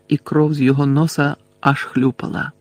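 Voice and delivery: ordinary delivery, nothing marked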